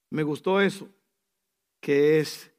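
ordinary delivery

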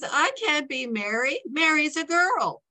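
lightly voiced boy objecting to his part; indignant protest.

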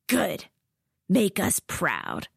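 slow, drawn out approval; lightly voiced cold instructor; slow menacing instructor. A narrator's reading. Laban speaks.

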